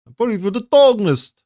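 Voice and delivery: put-on high, loud Russian-accented game character; Russian-accented voice-line impression; Mosin Nagan, Russian accent. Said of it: "the Russian accent".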